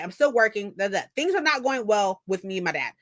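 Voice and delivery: normal talking voice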